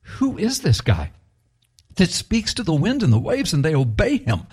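hushed, high-pitched mock astonishment; shocked disciples. Disciples asking who commands wind and waves, retold shock. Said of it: "the disciples were shocked and said".

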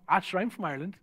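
put-on Irish accent. Shown as a demonstration. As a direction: lay on the Irish, understated. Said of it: "you always add on the Irish".

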